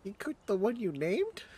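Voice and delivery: high, loud shocked question; shocked disbelief. Acted. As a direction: react in appalled disbelief. Shocked friend.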